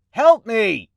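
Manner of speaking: high yell to another room; calling out loudly. Acted. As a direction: holler for her across the house. Yelling for help.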